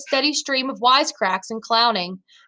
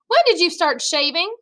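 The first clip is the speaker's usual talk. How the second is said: higher-pitched called question; calling out; 7 semitones higher than usual. A narrator's reading. Younger brother questions his brother from the bathroom, calling out. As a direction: call the question through to another room. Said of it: "I called from the bathroom".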